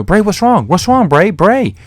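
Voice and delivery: hammed-up fake worry, asking what's wrong; fake concern. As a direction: play the innocent, pitch way up. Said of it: "totally faking it".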